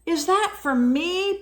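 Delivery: gasping surprise. A narrator's reading. Polar Bear finding the crate at his door, gasping surprise. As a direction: ask it with a little gasp of disbelief. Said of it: "Polar Bear gasped".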